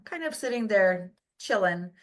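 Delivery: ordinary talk, nothing marked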